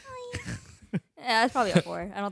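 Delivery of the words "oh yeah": high, softer squeaky moan; tiny fake moan of pleasure; mock climax. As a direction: squeak it quietly as a bedroom joke. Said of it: "He's about to nut".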